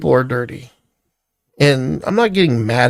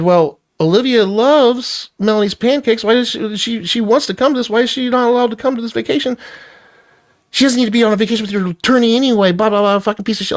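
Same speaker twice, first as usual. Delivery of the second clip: high heated retelling of his side; retold argument; 9 semitones higher than usual. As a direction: press his case up high and fast. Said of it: "So I said".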